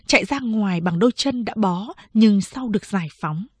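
usual speaking voice

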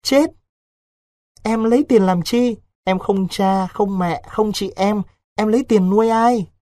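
put-on a frightened girl begging her lover to stay; pleading young girl. A narrator's reading. Vịnh begging Đối not to go to Laos, high and pleading.

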